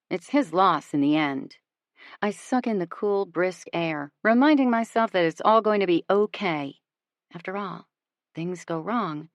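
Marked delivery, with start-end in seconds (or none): none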